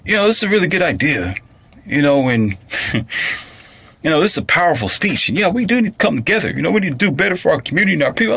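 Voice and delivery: fast, high, mock-earnest girl's voice; imitating the girl. Mocking the girl who praised the speech, high and fast. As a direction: mimic her gushing over the speech. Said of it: "she was like".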